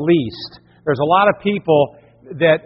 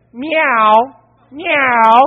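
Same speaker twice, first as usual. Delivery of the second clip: higher meow to lure a cat; mock meow; 6 semitones higher than usual. Father meows up at the cat, playful. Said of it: "So I said".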